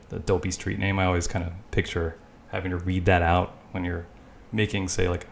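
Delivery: normal talking voice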